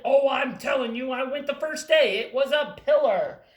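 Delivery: loud emphatic claim; loud certain mouse; insisting mouse. Acted. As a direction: tell them firmly you were there. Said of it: "the red mouse who said".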